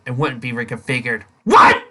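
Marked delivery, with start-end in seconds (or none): 1.4-1.8 sudden high, loud what; shocked what. Real, not acted. Reviewer reacting to the fic in disbelief, high loud what. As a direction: blurt it out in disbelief.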